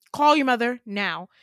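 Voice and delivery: loud, forceful acted order; insistent command. Dad insisting child call mother, loud forceful command. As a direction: raise the voice and demand it at once.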